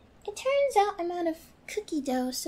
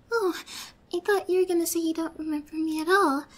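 usual speaking voice

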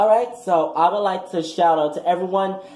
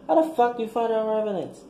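high mocking quote of a hater; 7 semitones higher, faster than usual. Mocking the haters at graduation, pitched up. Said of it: "this is what these bitches say".

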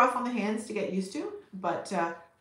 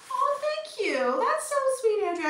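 much higher, bright gushing thanks; delighted thanks. Real, not acted. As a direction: gush over a sweet comment from a viewer. Thanking a viewer for kindness.

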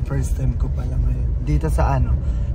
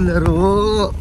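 usual speaking voice

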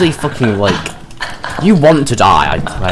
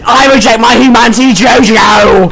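hammed-up anime villain yell; dramatic shout; 8 semitones higher, 5 dB louder than usual. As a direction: bellow it like a villain. Meme shout before monster.

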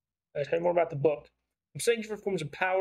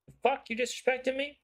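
sharply higher, threatening street thug; aggressive challenge; 9 semitones higher than usual. Acted. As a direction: get in his face, high and menacing. Thug picks a fight.

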